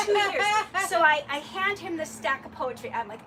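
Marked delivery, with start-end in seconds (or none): 0.1-0.4 higher, louder laughing boast; mock triumphant. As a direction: crow about it while laughing. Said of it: "And I went".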